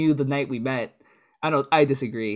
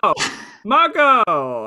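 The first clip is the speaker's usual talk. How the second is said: calling out; 6 semitones higher, 5 dB louder, much slower than usual. Real, not acted. Calling out to the editor.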